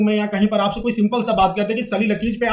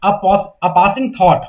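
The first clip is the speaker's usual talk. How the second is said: very slow, louder dictation; 6 dB louder, much slower than usual. Shown as a demonstration. Teacher dictating a definition slowly for students to write. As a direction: spell it out word by word for notes. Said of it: "तो aside का definition लिखिए".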